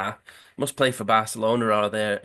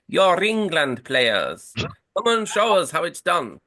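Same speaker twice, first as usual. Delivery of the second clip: put-on slow, higher posh accent for the Uruguayan captain; 7 semitones higher, 3 dB louder, slower than usual. Uruguayan captain challenging the England players, in a posh accent. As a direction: drawl it out in a plummy posh accent. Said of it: "he's got a really posh accent".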